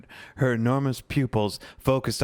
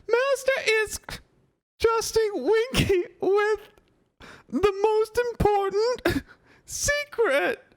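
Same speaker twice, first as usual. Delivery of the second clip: very high, halting elf squeak; an elf swelling with importance; proud tipsy Winky. Acted. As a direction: drunk house-elf whispering up her big secret. Winky boasting of secret.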